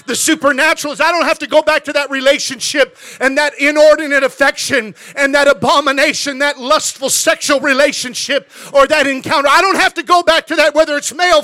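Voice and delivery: preacher rising in intensity; building sermon. Acted. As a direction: build the list to a preaching climax.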